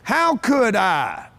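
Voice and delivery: drawn out, every word weighted; slow emphatic question. Shown as a demonstration. Speaker drilling the new question into the audience, slow and deliberate. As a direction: stretch it slowly so it sinks in. Repeating the key phrase to teach.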